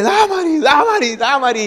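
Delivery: high, loud calls for a missing wife; retold frantic shouting. Husband calling for his vanished wife, acted panicked shouting. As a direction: act out yelling her name, starting very high. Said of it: "empecé a gritar".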